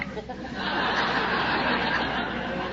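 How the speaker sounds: soft unvoiced laugh at the memory; a hushed laugh under the breath; breathy laugh. Real, not acted. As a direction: chuckle quietly at your own foolishness. Laughs at her own story.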